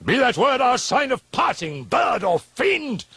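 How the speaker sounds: high, loud shriek; a furious shriek at the bird. Acted. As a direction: drive the bird off with a scream. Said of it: "I shrieked".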